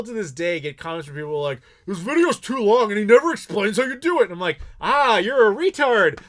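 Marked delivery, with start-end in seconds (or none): 1.8-4.2 higher, louder whiny complainer; mocking complaint in a put-on voice; mock commenter. 4.8-6.2 loud, slow, higher mock outburst; hammed-up loud exasperation; exasperated retort.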